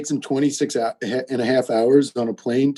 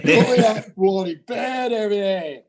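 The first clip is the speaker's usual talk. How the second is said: higher mock hillbilly British accent; 4 semitones higher than usual. Shown as a demonstration. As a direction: echo it in a pitched-up Southern-tinged British accent. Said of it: "Just, yeah, hillbilly British".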